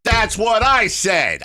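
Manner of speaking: loud, high, drawn-out protest; indignant retort. Retold or imitated. Host retelling his protest over the test, indignant.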